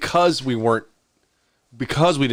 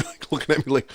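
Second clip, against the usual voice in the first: ordinary delivery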